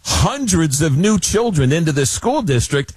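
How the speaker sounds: high-pitched outrage, without real shouting; mock screaming protest. Schools objecting to tax breaks, mock screaming protest. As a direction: imitate indignant schools, pitched well up. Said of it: "screaming at the top of their lungs".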